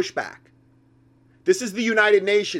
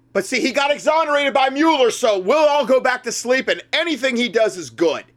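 sarcastic mock; 6 semitones higher than usual. Sarcastic mock of Trump supporters.

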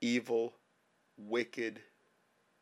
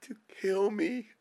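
crying. Real, not acted. Sobbing prayer about dying children.

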